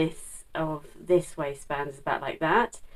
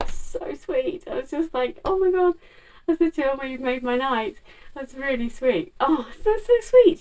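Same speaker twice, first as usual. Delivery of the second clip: high-pitched delighted gush; gush, voice lifting right up on the sweetness; thrilled and melting at a lovely compliment; touched gushing; 9 semitones higher, 4 dB louder than usual. Real, not acted. Touched, gushing over the waitress's compliment.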